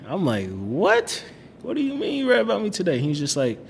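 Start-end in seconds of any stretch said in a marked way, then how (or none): 0.5-3.0 retold shock, leaping high and fast; baffled disbelief. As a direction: act out a startled, high-pitched double take. Retold shock at classmate's remark.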